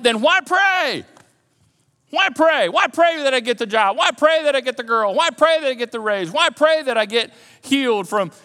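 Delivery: loud, high-pitched protesting question; doubting listener. Acted. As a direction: voice an objector's exasperated challenge. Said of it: "maybe you're thinking at this point".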